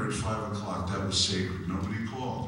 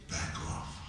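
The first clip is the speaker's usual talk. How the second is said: mock mild back off; 8 dB quieter than usual. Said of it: "screaming out the more politically correct phrase".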